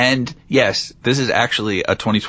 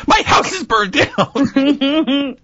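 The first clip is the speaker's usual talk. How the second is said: slow, high, hammed-up wail; mock despair; much slower than usual. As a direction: wail about the burned house, drawn out. Mock dramatic lament.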